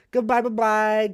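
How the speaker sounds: a loud sign-off to stream viewers; loud goodbye. Shown as a demonstration. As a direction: belt out the farewell. Repeated goodbyes, fading out.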